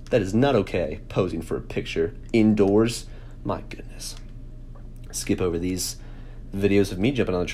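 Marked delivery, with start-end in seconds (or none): 3.4-4.2 breathy embarrassed whisper. Real, not acted. Vlogger cringes at own photo, whispering. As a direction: whisper it in horror.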